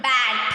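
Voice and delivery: "bat" said as one short unit; one-syllable demonstration. Shown as a demonstration. Teacher showing pupils a one-syllable word, demonstrated clearly. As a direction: say "bat" in a single beat.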